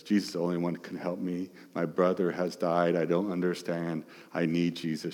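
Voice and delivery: soft, fast, pleading inner voice; desperate plea. Retold or imitated. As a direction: hurry it out, quietly desperate. Voicing Mary's desperate thoughts.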